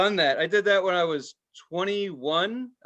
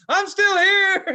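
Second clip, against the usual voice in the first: very high, loud, slow strained whine; pathetic plea; 9 dB louder, slower than usual. Retold or imitated. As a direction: call out feebly from the tent. Tripper calling from tent again.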